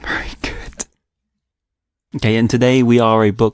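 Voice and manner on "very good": whispering. Pretend. Mock approval, whispered.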